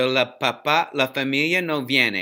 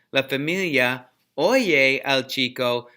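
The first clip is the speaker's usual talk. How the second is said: ordinary delivery, nothing marked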